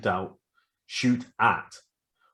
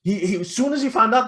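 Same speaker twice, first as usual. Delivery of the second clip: a real outburst, far louder and higher; emphatic shout; 10 semitones higher, 6 dB louder than usual. Real, not acted. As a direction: raise the voice high and loud on kill the guy. Heated retelling of the assault.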